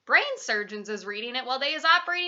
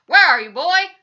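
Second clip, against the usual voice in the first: loud, higher fatherly demand; shouting; 6 semitones higher, 15 dB louder than usual. A narrator's reading. Daddy cannot see his son, shouting. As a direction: shout for a missing boy. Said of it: "and he shouts".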